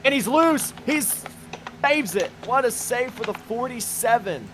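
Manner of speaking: excited race call. Real, not acted. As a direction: call the near-crash with rising excitement. Near-crash call in the race.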